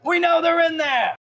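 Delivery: very high, loud, drawn-out yell; bandit shouting outside. Acted. Bandit yells to shopkeeper through door, first attempt, open shout. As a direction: holler the accusation through the door. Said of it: "trying to get the little echo".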